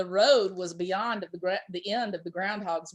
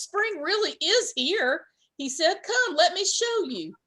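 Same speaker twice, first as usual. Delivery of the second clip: eager; 7 semitones higher than usual. Acted. Groundhog insists eagerly.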